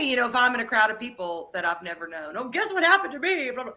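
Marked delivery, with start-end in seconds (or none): 2.4-3.7 high, gushing pretend announcement; mock oversharing. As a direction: play the over-eager oversharer.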